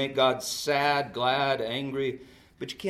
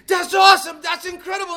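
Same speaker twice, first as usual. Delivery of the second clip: very high, loud shout of praise; gushing mother's cheer; 12 dB louder than usual. Retold or imitated. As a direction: imitate an over-thrilled mom cheering. Mother cheering her girl, retold.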